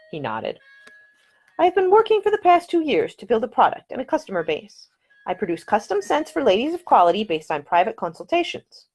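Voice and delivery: Ida's voice. Acted.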